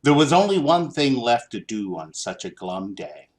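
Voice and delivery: ordinary delivery, nothing marked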